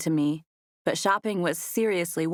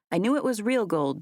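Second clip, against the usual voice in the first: usual speaking voice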